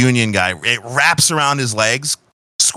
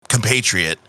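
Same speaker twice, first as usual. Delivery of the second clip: normal talking voice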